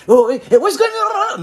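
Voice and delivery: exaggerated American accent, jumping high and loud; mock American accent. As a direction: put on an over-the-top American English accent. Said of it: "de me casser les couilles encore pour parler". (faux accent américain exagéré)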